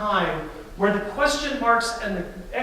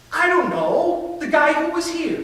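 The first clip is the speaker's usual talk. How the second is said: distraught Mary; 7 semitones higher, 4 dB louder than usual. Acted. Mary answers the gardener, distraught.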